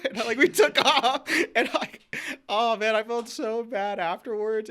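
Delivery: high, slow laughing narration. Real, not acted. Retelling the crash to a friend, laughing. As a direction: tell it while cracking up.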